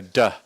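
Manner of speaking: a short "duh" in place of a flipped R; tapped "duh" standing in for an R. Shown as a demonstration. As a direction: say "duh" with a plain D, demonstrating. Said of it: "just say a D".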